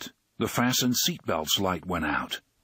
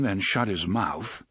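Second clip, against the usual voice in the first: ordinary delivery, nothing marked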